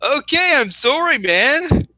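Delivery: high, loud rushed-out apology; flustered apology. Real, not acted. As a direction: plead as if being yelled at. Apologizing after being screamed at.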